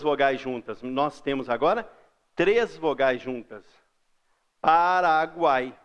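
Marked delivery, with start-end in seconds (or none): 4.6-5.7 "Paraguai" slowly, letting U, A and I be heard; slow teaching "Paraguai"; drawn-out triphthong. Shown as a demonstration. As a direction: stretch "guai" in "Paraguai" through all three vowels. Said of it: "tem o U".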